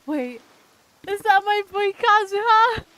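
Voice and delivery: high loud thrilled shriek; shrieking jackpot winner. Real, not acted. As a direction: shriek like you just won. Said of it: "I'm literally gonna cry".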